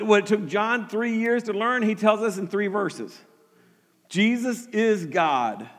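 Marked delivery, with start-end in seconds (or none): 4.1-5.7 drawn-out, measured "Jesus is God"; slow and deliberate. Acted. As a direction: let it sink in slowly. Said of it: "John is emphatic about this".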